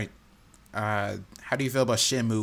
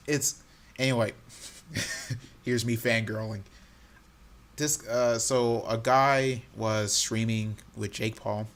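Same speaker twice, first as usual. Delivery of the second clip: ordinary delivery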